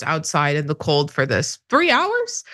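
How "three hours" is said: high, incredulous question; mock disbelief. Guest mocking the rioter's three-hour wait, high mock disbelief. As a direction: scoff in exaggerated disbelief, pitched way up.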